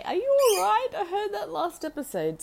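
high, loud, worried friend with a laugh; quoting a friend. Retold or imitated. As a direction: squeal the concern up high. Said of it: "I had Red Todd contact me and say".

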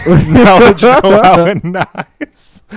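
loud and high through laughter; laughing. Real, not acted. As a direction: laugh the words out. Laughing repeat of the bit.

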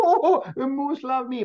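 high, loud mock-excited "oh"; high-pitched gushing imitation; imitated delighted squeal. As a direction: act out a guest thrilled to be loved. Guest's delighted reaction acted out.

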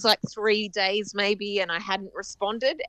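usual speaking voice

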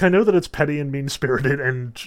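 ordinary delivery

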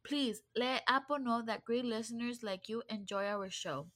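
soft, even sign-off read to listeners; podcast outro read. Acted. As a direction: wrap up the episode like a host's closing script.

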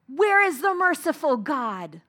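anguished cry from the crowd; yelled out. Retold or imitated. Onlooker at the gallows crying out to God, anguished. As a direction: cry it out in despair. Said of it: "someone behind him yells out".

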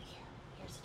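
hushed urgent whisper; breathy secret handoff whisper; whisper yelling. Retold or imitated. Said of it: "You also have to whisper".

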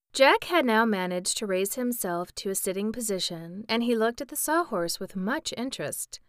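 ordinary delivery, nothing marked

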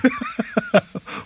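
a long falling laugh. Real, not acted. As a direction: laugh along at the joke. Host laughs back.

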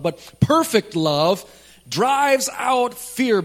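slow and rising, each word leaned on; slow emphatic build. Acted. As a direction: build to the peak of the verse. Scripture climax, slowed and raised.

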